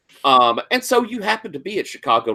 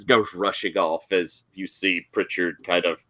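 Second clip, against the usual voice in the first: ordinary delivery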